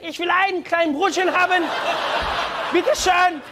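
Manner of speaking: high, loud dictator's oratory; Hitler. Acted. Customer ordering a roll in Germany, ranting like Hitler. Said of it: "a little bit like Hitler".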